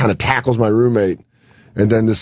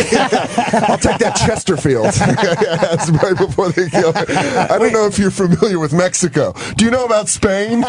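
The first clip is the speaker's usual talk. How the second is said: laughing Hopper quote; 8 semitones higher than usual. Retold or imitated. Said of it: "the Dennis Hopper one".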